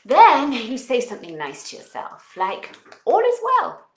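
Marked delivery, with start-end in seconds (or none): 3.0-3.8 high, loud happy affirmation; cheerful loud affirmation; affirmation called out. Shown as a demonstration. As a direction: call it out brightly. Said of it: "Try it out".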